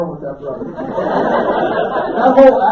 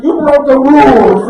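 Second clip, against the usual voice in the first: loud, raised-pitch retort; indignant shout; 4 semitones higher, 12 dB louder than usual. Retold or imitated. Husband to his upset wife, loud indignant shout. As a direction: fire back loudly in self-defence.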